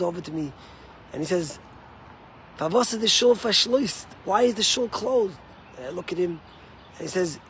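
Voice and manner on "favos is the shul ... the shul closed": lightly voiced Yiddish-speaking man, raised and loud; retold insistent question; puzzled stranger. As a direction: ask it urgently, imitating a bewildered latecomer. Stranger questioning the closed shul.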